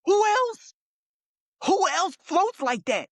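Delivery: high-pitched, incredulous challenge; worked-up insistence. Real, not acted. Challenging a co-host who doubts the Ali comparison, high and worked up. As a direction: argue it excitedly, voice way up.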